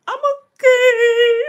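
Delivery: trying to hold back tears and failing; tearful I'm okay. Real, not acted. Answering dad while crying.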